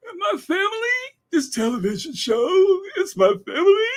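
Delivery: high, wailing mock sob, shouted at the end; mocking her performance. Mocking Allison's tearful performance, high wailing mock sob. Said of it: "she sure enough put on a performance".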